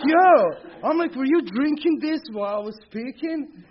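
very high, loud amazed outburst. Retold or imitated. To the guy with the lid, a very high loud amazed outburst. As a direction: shout it out in disbelief.

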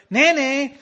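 high, loud nagging mom voice; shrill-free but high, pushy mother call; mock mother nagging; mother's nag. Imitating mother nagging him.

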